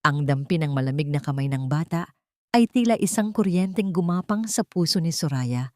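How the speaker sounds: usual speaking voice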